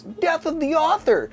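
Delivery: high-pitched mock outcry; mock scream. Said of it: "scream at the top of your lungs".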